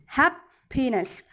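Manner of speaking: slow "happiness", punched "hap", pause, falling "piness"; stress on first syllable. Shown as a demonstration. Teacher giving example, slow "happiness" with stress on "hap". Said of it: "the stressed syllable is in hap".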